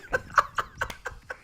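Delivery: breathless, voiceless laughter; real laughing fit without voice. Real, not acted. Cracks up at snail trails.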